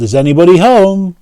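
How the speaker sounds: high, loud call into the cave. A narrator's reading. John calls into the troll's cave, high and loud. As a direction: call out to see who's there.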